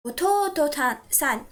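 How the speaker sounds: "ototo-san" slow, middle "to" drawn out; stress on to. Shown as a demonstration. Teacher modelling younger brother word, slow with long "to" in "ototo-san". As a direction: stretch the first "to" in "ototo-san". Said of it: "going to stress the syllable to".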